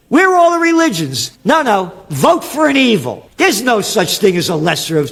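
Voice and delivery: high, loud railing at the clergy; ranting shout. Real, not acted. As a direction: hammer them with each question. Ranting on about religions.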